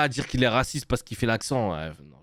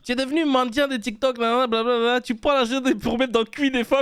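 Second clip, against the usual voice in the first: high-pitched mock Ivorian accent; imitated Ivorian accent; 8 semitones higher than usual. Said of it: "t'es en train de prendre un accent là?". (imitation aiguë de l'accent ivoirien)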